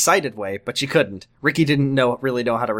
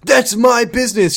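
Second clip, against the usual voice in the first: loud, high-pitched defensive retort; snapping defensively; 9 semitones higher, 6 dB louder than usual. A narrator's reading. Ricky slips into old ways with Amy, loudly defensive. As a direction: fire it back, hammed-up and loud. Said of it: "slipping into his old ways".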